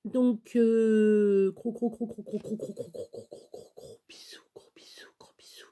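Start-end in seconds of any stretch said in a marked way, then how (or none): 1.6-5.7 kisses fading from soft voice to an unvoiced whisper; playful fade-out whisper. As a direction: let each repeat get softer until it's only breath.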